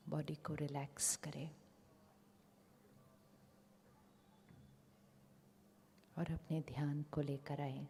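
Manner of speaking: very soft, unhurried meditation voice; guided meditation. Real, not acted. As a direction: settle them quietly, with long pauses.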